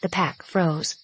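usual speaking voice

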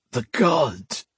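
a lightly croaked pair of words; a wounded Alesh croaking to the ghost. Acted. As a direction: croak it with some relief. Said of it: "he croaked".

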